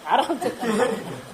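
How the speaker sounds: आराम से drawn out slowly and softly, half laughing; amused, unhurried आराम से; slow and calm. Shown as a demonstration. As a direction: stretch आराम से slowly with a chuckle. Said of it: "हड़बड़ा के नहीं, घबरा के नहीं".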